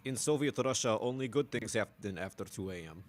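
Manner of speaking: a hint of a Russian accent; mock Russian accent. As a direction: joke Russian comic voice, lightly done. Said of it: "so much Russian accent".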